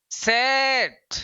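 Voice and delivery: सेट with a short clear e, stretched very slowly; pronunciation demo. Shown as a demonstration. Teacher drawing out सेट for students, slow demo. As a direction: draw out सेट for the class. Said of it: "अब उच्चारण कैसे करेंगे".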